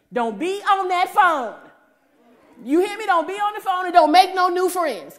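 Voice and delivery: high, loud, slow stern warning from the husband; husband's loud warning before a trip. Retold or imitated. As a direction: lay down the law like a protective husband. Husband's stern instructions voiced.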